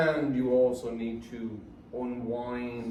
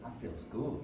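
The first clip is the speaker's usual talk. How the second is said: quiet; 5 semitones lower, 14 dB quieter than usual. Retold or imitated. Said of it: "everybody ... is like".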